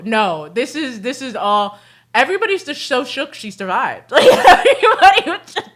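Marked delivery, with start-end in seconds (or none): 4.1-5.7 laughing. Real, not acted. Laughing while telling story.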